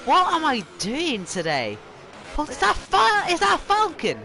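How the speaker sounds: high, loud disbelief; real startled exasperation; exasperated surprise. Real, not acted. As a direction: squeak it out in disbelief. Chaos in race.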